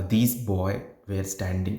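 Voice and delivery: put-on "These boy were standing", "boy" with no final S; slow misreading, "boy" left bare of its S; S dropped. Shown as a demonstration. Teacher showing a learner's mistake, S left off "boy". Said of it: "you have read the boy".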